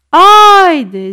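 high, loud, drawn-out call; urging shout. A narrator's reading. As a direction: hurry him out with a shout. Grandmother urges boy out. (strigăt de îndemn)

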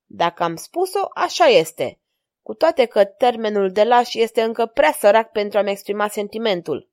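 ordinary delivery

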